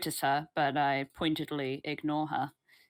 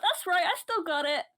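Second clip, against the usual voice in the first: gloating; 13 semitones higher than usual. Acted. Delilah gloats over stolen gold.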